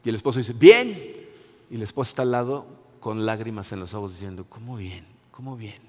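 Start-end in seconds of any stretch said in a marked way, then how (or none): 0.5-0.9 loud, high clipped answer; a husband brushing it off; mock curt husband. Esposo responde en el ejemplo. 4.5-5.8 very soft, low disbelief; a wife near tears, barely audible; tearful wife. Retold or imitated. Esposa llorosa en el ejemplo.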